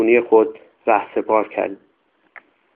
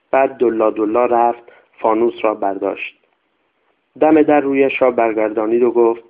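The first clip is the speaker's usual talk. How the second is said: usual speaking voice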